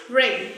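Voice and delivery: "Ring" begun straight on R, W silent. Shown as a demonstration. Teacher showing how to pronounce it, silent W in Ring. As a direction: say "Ring" with no W sound. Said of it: "The W is silent over here".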